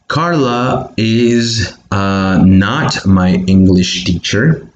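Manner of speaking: "Carla is" said very slowly; slow dictation of "Carla is"; slow model. Shown as a demonstration. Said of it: "And then you say".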